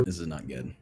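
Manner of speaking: low, very soft dread-free aside; quietly quoting onlookers; hushed quote. Retold or imitated. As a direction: drop the voice right down low. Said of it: "they'll go".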